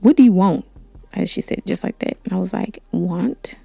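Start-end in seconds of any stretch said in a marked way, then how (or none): 0.0-0.6 curt, hostile "What do you want?"; mock-aggressive teacher snapping. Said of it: "she says aggressively". 2.9-3.5 hushed, half-whispered "Want? But okay."; quiet inner reaction, puzzled; to herself. Retold or imitated. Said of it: "that's what I'm saying to myself".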